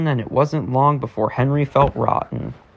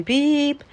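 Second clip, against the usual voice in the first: high horn beep; 11 semitones higher than usual. Acted. Imitated engine horn.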